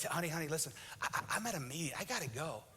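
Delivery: whisper-quiet, stuck in a meeting; hushed hurried husband. Retold or imitated. Husband in a meeting hushes wife on the phone.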